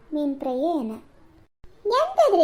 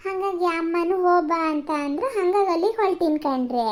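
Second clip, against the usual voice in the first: ordinary talk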